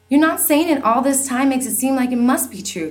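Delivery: high, loud upset outburst; hurt daughter crying out. Acted. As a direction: cry it out in protest. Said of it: "cried Lizzie".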